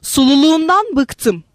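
fed-up, irritated retort; exasperated narrated snap; angry outburst. A narrator's reading. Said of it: "öfkesini sırtıma saplıyor".

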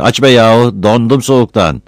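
loud, impatient shouting through the door; loud, pushy shouting, fast at the end. Acted. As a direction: yell it from out in the cold. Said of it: "Battal öyle bir bağırıyordu ki".

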